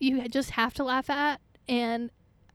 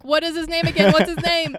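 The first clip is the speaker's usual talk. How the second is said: loud, higher, pleading question to a bandmate; mock urgent asking; 4 semitones higher, 8 dB louder than usual. Asking Eric the actor's name, loud and pleading. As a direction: call out what's his name, louder.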